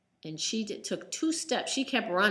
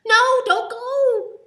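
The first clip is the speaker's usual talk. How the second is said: high, loud, slow desperate cry; panicked plea; 14 dB louder, much slower than usual. Acted. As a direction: beg him not to leave you alone. Danny begs friend to stay.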